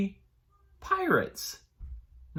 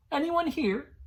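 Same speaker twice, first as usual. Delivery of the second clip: calling out. Acted. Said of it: "Goldenlocks called".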